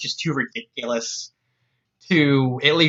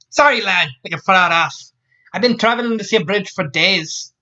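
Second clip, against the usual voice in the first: higher-pitched Scots woman's voice; Scots accent; 5 semitones higher than usual. Acted. Scotswoman telling Jack he is far off, Scots accent. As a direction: put on a breezy Scots accent. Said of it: "she said in a Scots accent".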